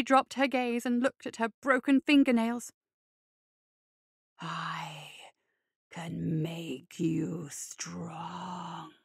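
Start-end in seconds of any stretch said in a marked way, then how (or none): 4.4-8.9 low, slow, tempting whisper; spirit whisper. A narrator's reading. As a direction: breathe the offer close to her ear. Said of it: "December whispered".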